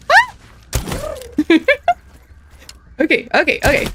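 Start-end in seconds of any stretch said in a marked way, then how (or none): 0.1-1.9 high yelp into a laugh; startled yelp and laugh. Real, not acted. Gamer yelping then laughing mid-fight.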